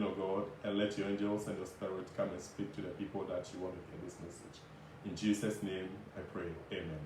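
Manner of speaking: fast, hushed prayer fading at line ends. Acted. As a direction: pray quickly and softly. Opening prayer before message.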